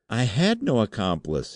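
high-pitched desperate denial; abject, panicky protest; frightened cry. A narrator's reading. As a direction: cry out as a cornered wretch. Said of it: "he cried".

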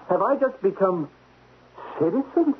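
nervous laugh of disbelief at being demoted; aghast disbelief. Acted. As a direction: react in horror to the demotion.

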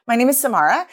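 "Samara" rising like a question; upspeak. Shown as a demonstration. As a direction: state the name but lift "Samara" at the end, half asking. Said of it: "It's doing double duty".

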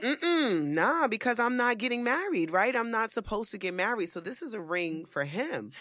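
high, loud headshaking denial. Retold or imitated. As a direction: refuse it loudly, in disbelief. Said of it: "And I'm like".

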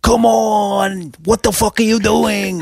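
hammed-up angry Mets fan; imitating a fan yelling. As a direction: yell at the field like a diehard fan. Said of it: "he just kept shouting things like".

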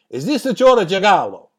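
mock Italian accent; over-the-top Italian accent. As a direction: ham up a thick Italian accent on the phone. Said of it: "most over-the-top and unbelievable Italian accent".

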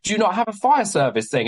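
high-pitched disbelieving question; incredulous question. Retold or imitated. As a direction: question him as if it can't be true. Retold questioning of venue owner.

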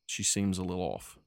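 ordinary talk, nothing marked

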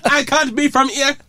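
high, laughing, playful mock offence; mock outrage. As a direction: squeal it out in fake indignation. Mock offended at being called foreign.

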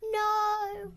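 loud protest. Real, not acted. Child protests loudly.

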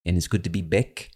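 "back" as "bick", New Zealand vowel; New Zealand accent. Retold or imitated. Quoting the clip's speaker to listeners, New Zealand accent on "back". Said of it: "I think he said something like".

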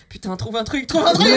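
panicked inner shout. Retold or imitated. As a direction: shout in a panic to find something. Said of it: "j'étais là".

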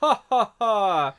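belly-laugh like Santa then wonder aloud. Retold or imitated. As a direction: Santa laughing over the valley, hammed up. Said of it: "And he's like".

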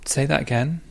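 cool, level "Say that again."; unruffled comeback; calm challenge. Shown as a demonstration. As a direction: hand it back to them steadily. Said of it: "you say".

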